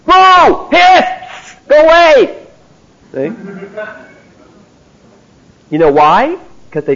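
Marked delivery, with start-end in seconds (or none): 0.1-2.4 much higher and louder mock boos and hisses; jeering crowd. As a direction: heckle like a rowdy crowd outside a disco. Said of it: "And they're going".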